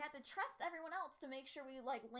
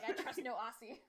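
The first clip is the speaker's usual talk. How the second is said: imitating gruff line; 7 dB louder than usual. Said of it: "you're like".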